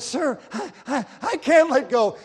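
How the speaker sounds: high, loud, broken stammer; stammering in shock. Acted. Shocked sailor pleads with the captain, stammering and loud. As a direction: stumble over the words, desperate.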